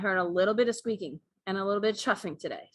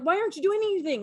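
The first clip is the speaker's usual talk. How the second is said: higher, rushed exasperation; exasperated at cat; 8 semitones higher, faster than usual. Retold or imitated. Owner scolding the cat for just watching, fast and exasperated. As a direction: scold the lazy cat quickly. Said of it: "I'm like".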